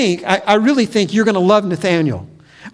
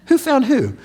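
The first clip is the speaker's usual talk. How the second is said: laughing question; 5 semitones higher than usual. Acted.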